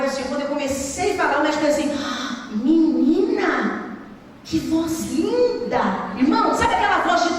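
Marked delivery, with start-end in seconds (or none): 2.0-5.8 slow, drawn-out gasp of wonder; amazed doctor. Retold or imitated. Doctor hearing patient's recovered voice, amazed. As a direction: stretch out the admiration, astonished.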